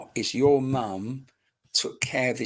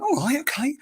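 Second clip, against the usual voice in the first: much higher, a mock startled okay; high yielding all right; taken aback; 14 semitones higher than usual. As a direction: back off quickly as if corrected. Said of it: "I went".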